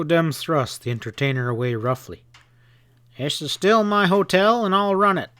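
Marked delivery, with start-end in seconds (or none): 3.2-5.3 angry assertion. Acted. Joe shoves Kitty, asserts control.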